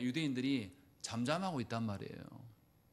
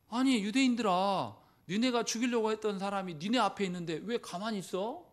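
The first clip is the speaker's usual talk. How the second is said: mocking taunt; 5 semitones higher, 4 dB louder than usual.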